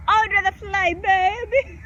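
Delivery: high, sing-song teasing answer; playful high "fly, baby". Acted.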